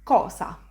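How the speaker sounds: cosa as "KAW-sa", loud first syllable; Italian word demo. Shown as a demonstration. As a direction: punch the open O in cosa. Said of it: "An example of this sound is in the word".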